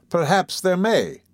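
cool, measured concession; composed. A narrator's reading. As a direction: answer unhurriedly, giving nothing away. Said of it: "was the composed reply".

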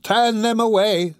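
high, slow, drawn-out deathbed plea; drowsy dying woman. A narrator's reading. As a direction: order them out in a high, slow voice. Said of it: "said the woman drowsily".

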